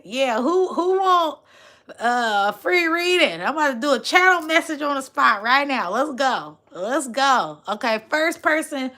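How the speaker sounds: high, loud call to viewers; rally the chat; excited host shout; hyped. Real, not acted.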